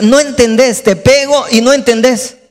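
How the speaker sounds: high-pitched acted rebuke; exasperated scolding. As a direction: scold a child who won't listen. God scolds like exasperated parent.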